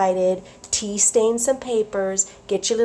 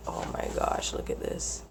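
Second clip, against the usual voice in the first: awed whisper; 11 dB quieter than usual. Real, not acted. Admiring paper.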